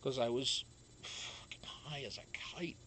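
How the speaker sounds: breathy secret whisper. Real, not acted. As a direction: confide it under your breath. Whispered aside.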